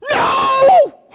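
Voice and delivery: high, loud shouted no; sharp shout. Retold or imitated. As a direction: bark a sudden protest. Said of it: "And I'm going".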